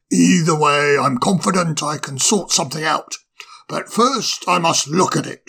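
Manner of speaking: a genial owl putting the guest at ease; wise old owl. Acted. Old owl reassures the fire sprite, kindly.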